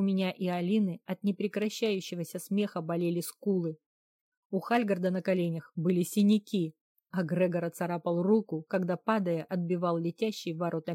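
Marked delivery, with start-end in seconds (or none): none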